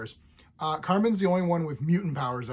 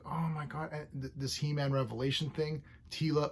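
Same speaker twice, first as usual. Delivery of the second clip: ordinary talk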